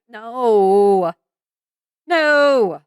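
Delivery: usual speaking voice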